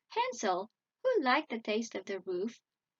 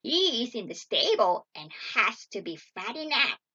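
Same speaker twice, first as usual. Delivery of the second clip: loud, menacing witch voice; commanding witch; 7 dB louder than usual. A narrator's reading. Witch sends Gretel for water, loud wicked orders. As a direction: order her about loudly and wickedly. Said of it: "she cried".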